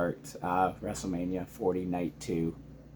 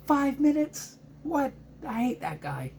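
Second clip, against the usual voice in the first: imitating wife annoyed. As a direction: keep the high put-on wife voice, indignant. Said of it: "My wife's like".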